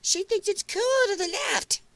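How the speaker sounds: very high, exaggerated parody voice; over-the-top mocking impression, held high; mock put-on talk. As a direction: mimic her adopted way of talking in a squeaky pitch. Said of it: "Now she's talking like this".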